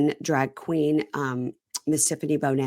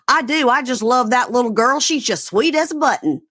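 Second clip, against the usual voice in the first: put-on Kentucky accent; 8 semitones higher, 7 dB louder than usual. Host mocking a man suddenly sounding from Kentucky. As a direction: gush like a sweet Kentucky man. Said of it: "now all of a sudden you're from Kentucky".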